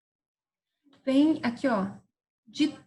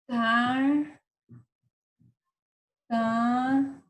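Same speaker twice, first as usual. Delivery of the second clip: TARTARUGA slowly, long pauses between tar, ta, ru and ga; syllable by syllable; much slower than usual. Shown as a demonstration. Teacher writing an example, tartaruga in widely spaced syllables. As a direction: dictate tartaruga in four drawn-out pieces. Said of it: "passou de quatro sílabas".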